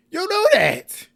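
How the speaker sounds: very high, loud, stretched-out cry; exasperated outburst. Retold or imitated. Recapper answering the apologetic bride, exasperated loud outburst. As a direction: wail it slowly, high and loud.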